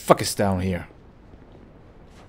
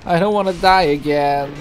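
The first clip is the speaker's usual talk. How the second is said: loud anxious plea; 7 semitones higher, 7 dB louder than usual. Real, not acted. Fears dying again.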